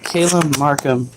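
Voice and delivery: usual speaking voice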